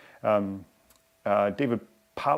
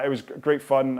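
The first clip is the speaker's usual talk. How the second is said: usual speaking voice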